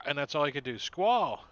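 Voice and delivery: ordinary talk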